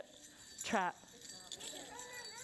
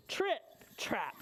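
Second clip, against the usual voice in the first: ordinary delivery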